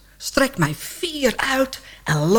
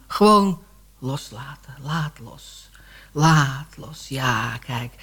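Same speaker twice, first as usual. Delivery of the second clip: lower, softer, calm self-soothing; low meditative murmur, much softer; soothing relaxation; 10 semitones lower, 4 dB quieter, slower than usual. Acted. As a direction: talk yourself into letting go. Talking herself into relaxing.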